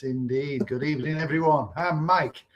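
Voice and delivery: usual speaking voice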